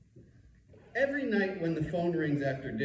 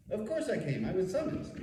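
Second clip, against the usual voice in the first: wry; 7 semitones higher than usual. Retold or imitated. His retold reply.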